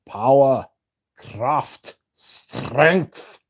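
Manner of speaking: emphatic Germanic catchphrase, word by word; hammed-up Prussian impression, rising on the last word; Jeremy Fragrance. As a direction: declaim each word as a separate slogan. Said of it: "Do Jeremy Fragrance".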